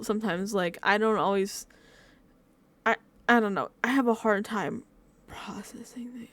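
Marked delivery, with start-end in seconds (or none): 5.3-6.3 slow, dropped to a whisper; trailing whisper. Real, not acted. Vlogger confessing a struggle, trailing off in a whisper. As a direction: let the words fade into a whisper. Admitting she struggles processing.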